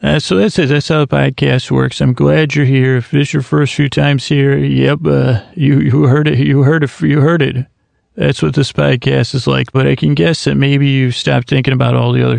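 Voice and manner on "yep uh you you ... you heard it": ordinary talk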